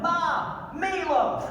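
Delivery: a hint of a whiny basement yell, high pitched; mock a grown man yelling for his mom. Mocking a gamer calling his mom from the basement, high mock yell. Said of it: "basement yelling out".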